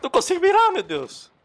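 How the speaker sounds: very high, loud squeal; high-pitched gamer panic; panicked shout. Real, not acted. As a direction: squeal it in helpless frustration. Cannot aim under fire.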